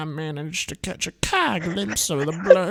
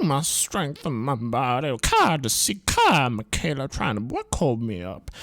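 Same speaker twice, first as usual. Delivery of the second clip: ordinary talk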